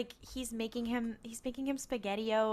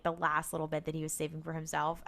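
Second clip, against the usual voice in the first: ordinary talk, nothing marked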